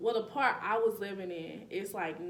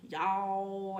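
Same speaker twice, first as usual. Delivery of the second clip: imitated Mississippi country accent, a bit louder; 4 dB louder than usual. Quoting Mississippi locals' sayings, country accent. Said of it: "like, the country accents".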